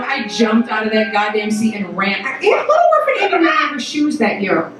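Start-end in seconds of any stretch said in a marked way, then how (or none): none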